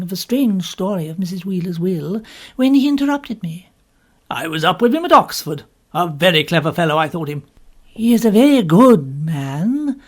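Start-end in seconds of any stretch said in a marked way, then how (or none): 4.3-7.4 a hint of a lower, louder gentleman's voice; lightly voiced Woodhouse. A narrator's reading. 7.8-10.0 firm and slow, leaning on each word; emphatic, deliberate reply; with emphasis. A narrator's reading. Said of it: "I answered with some emphasis".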